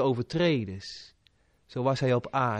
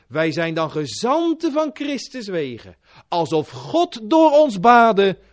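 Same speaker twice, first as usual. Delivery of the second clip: loud, high fervent pulpit preaching; preaching at full force; 5 semitones higher, 7 dB louder than usual. Acted. Preacher pleads with the listeners, fervent loud preaching. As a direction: urge the congregation with rising passion.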